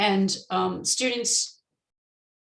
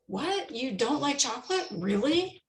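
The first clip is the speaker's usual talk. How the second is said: higher, softer voice for the surprised "What?"; mock surprise; 5 semitones higher, 4 dB quieter than usual. Teacher voicing a surprised friend in a sample dialogue, mock surprise. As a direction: act startled that anyone dislikes chocolate.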